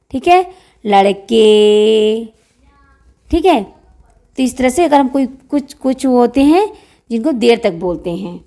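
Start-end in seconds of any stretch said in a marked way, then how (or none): 0.8-2.2 put-on लड़के stretched very slowly, as if calling a boy from afar; drawn-out call. Shown as a demonstration. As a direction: draw out लड़के like calling someone. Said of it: "देर तक हम बोल रहे हैं". (लड़के को बहुत खींचकर पुकारें)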